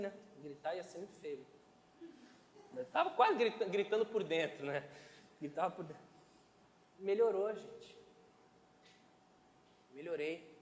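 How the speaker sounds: ordinary talk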